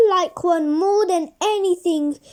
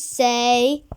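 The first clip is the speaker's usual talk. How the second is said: usual speaking voice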